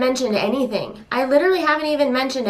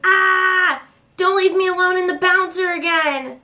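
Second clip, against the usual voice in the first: put-on whiny toddler voice; 8 semitones higher than usual. Toddler in her playpen begging mom, high whiny plea. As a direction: beg like a trapped toddler. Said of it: "Baby B is like".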